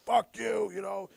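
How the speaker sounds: playful impression of a drunk dad swearing at staff; drunk dad rant. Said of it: "he's been fucking drinking and he's like".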